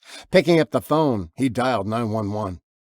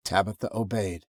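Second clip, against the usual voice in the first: usual speaking voice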